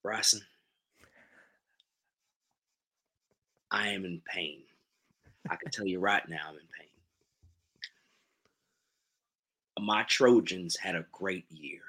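pained fan speaking in a put-on Southern accent. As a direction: play a mournful Southerner, taking your time. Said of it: "in your best Southern accent".

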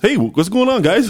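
high, chirpy pretend American accent; mock American accent. Imagining Arnold trying an American accent in a movie, high mock American. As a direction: put on an exaggerated American accent, laughing into it. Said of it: "an American accent in a movie".

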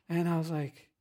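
ordinary delivery

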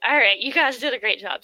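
loud, big-hearted praise for the players; hearty praise. Retold or imitated. DM praising the players, loud and hearty. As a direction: cheer the party on.

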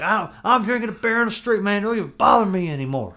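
high, loud devil-may-care drinker; carefree drunk voice. Retold or imitated. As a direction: play a loose guy drinking in the street. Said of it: "people are like".

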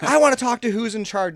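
high, loud mock demand; demanding customer. Customer demanding whoever is in charge, loud mock demand. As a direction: bark it like an entitled customer.

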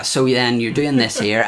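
ordinary talk, nothing marked

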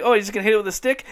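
quick, high, disbelieving comic voice; fast hammed-up skeptic; mock incredulous. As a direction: rattle off the question in mock disbelief. Retold skeptic questioning golf inventor.

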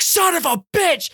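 high-pitched retold cursing fit; exasperated outburst. Driver retelling finding his window broken, exasperated outburst. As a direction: curse it out, pitch leaping up.

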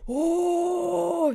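high, loud hammed-up shout; mock YouTuber yell. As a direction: mimic an obnoxious streamer. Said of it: "Just people yelling is obnoxious".

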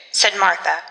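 ordinary delivery, nothing marked

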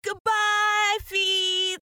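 Alice shouting down to her feet; calling goodbye. Acted. Alice calls to distant feet.

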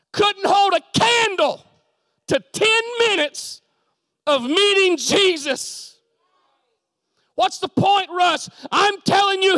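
high, loud, emphatic shouting; full-force preaching. Real, not acted. Preacher testifying that meeting Jesus beat meetings, full-force shout. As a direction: drive each phrase home at a shout.

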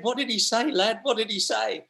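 lightly acted excited dad voice; imitated dad. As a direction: act a father keen to hear it. Acted dad eager for the verdict.

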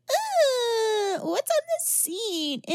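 high, shouted disgust at a grimy seat; grossed-out girl. Retold or imitated. Friend imitating a prissy girl at a punk bar, shrill disgust. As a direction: squeal it out like a prissy girl finding filth. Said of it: "she's like".

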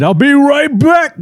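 high, slow, drawn-out call; mock killer catchphrase. Host quoting the other killer to jog memory, mock catchphrase call. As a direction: sing out the catchphrase, stretched and high.

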